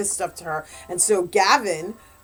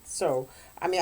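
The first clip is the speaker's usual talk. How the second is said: ordinary talk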